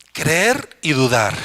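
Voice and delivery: "creer y dudar" stretched out slowly; preacher slowing down for the congregation to repeat; slow emphatic. Shown as a demonstration. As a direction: spell out "creer y dudar" word by word. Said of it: "Diga conmigo".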